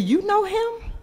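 a hint of a curious little boy; child's voice. Grandson's question voiced in story.

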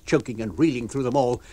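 ordinary delivery, nothing marked

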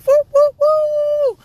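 long high whooping cheer; excited cheer. Real, not acted. Vlogger cheering about the new puppy, whooping loudly.

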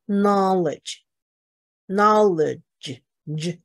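slow "knowledge" twice, then an isolated "J"; teacher linking "knowledge" to the J sound; dg sound demo. Shown as a demonstration. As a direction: say "knowledge" slowly and then the "J" on its own. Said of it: "就是这个音".

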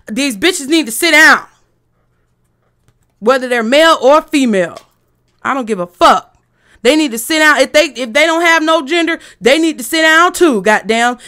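loud, fed-up tirade; irritated rant. Real, not acted. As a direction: tell them off, really irritated. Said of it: "And I am very irritated".

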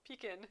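"Pekin" as PEE-kin, softly; grandma's pronunciation. Shown as a demonstration. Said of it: "my grandma says".

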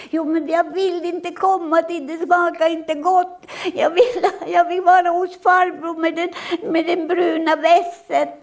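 put-on child refusing the soup; screaming child. Acted child voice. (skrikande barn som vägrar)

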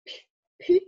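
"pu-, pute" with the t pushed out; exaggerated Danish pronunciation. Shown as a demonstration. Dane demonstrating for a learner, exaggerated pronunciation. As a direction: exaggerate "pute", popping the consonants. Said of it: "you have to exaggerate a lot in Danish".

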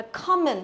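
"common" with short O, stress on first syllable; teacher's "common" with an open short O; correct model. Shown as a demonstration. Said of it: "Nó là âm O".

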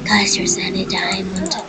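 hushed whisper to the viewers; whispering a discovery. Real, not acted. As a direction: share the secret under your breath. Sneaking through a mine.

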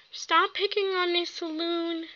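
ordinary talk, nothing marked

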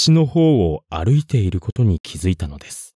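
ordinary delivery

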